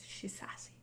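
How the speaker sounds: tiny unvoiced Cockney whisper; hushed, under the breath; barely audible. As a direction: whisper it almost to yourself. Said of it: "You wanna speak up a bit?".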